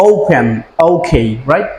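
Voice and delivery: ordinary talk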